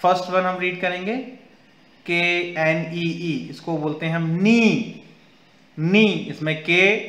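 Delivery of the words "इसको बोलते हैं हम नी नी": "नी" said as nee, no k sound at the start; silent k in knee. Shown as a demonstration. Teacher models "नी" for kids, k silent. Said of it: "के silent है".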